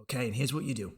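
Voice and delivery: usual speaking voice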